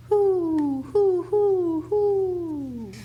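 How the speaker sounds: high, loud hoots of a distant owl; answering owl call. A narrator's reading. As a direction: hoot back from far through the trees. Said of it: "an echo came threading its way".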